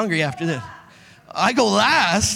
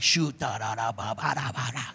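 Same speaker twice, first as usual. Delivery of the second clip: suppressed fury babble; 10 semitones lower, 9 dB quieter than usual. Retold or imitated. Suppressed rage mumbling.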